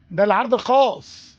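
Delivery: loud drawn-out special-offer cry; market hawker. Shown as a demonstration. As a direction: stretch it out like a vendor in the souk. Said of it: "واللي يقول لك عرض خاص بيقول كده".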